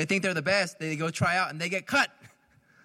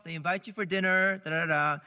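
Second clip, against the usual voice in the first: usual speaking voice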